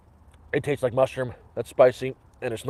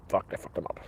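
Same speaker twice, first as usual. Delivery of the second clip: whispered curse; 12 dB quieter, faster than usual. Real, not acted. Swearing over ruined noodles.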